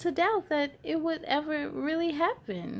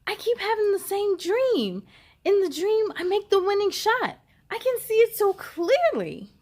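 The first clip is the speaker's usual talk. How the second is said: higher, louder eager telling; boy sharing his dream eagerly; excited; 8 semitones higher, 5 dB louder than usual. A narrator's reading. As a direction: gush about the dream. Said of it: "Aiden said excitedly".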